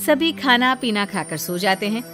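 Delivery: ordinary delivery, nothing marked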